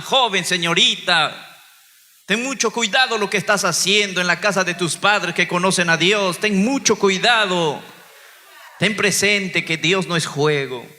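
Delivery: slow, weighty pulpit warning to the youth; earnest warning. Real, not acted. Pastor solemnly warns young listeners, slow and weighty. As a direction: lean on every word like a preacher's warning.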